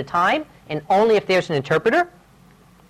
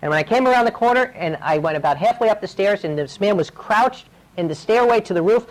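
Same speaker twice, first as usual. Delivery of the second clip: ordinary delivery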